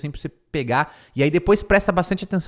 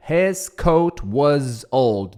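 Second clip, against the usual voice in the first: slow model reading; much slower than usual. Shown as a demonstration. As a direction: read the English line slowly and clearly. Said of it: "model English sentence".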